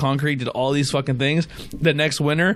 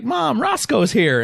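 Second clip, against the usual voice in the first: high, slow call to mom; a small child announcing the dog, drawn out; calling out as a little kid; 9 semitones higher, slower than usual. Retold or imitated. Retelling how he told his mom the dog had followed them, a high, slow kid's call.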